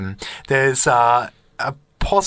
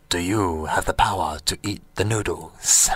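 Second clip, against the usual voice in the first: low, softer challenging voice; mysterious stranger; 7 semitones lower, 3 dB quieter than usual. Retold or imitated. Stranger at the noodle table challenging the dreamer, low and grave. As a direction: lower the voice, sizing him up. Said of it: "he goes".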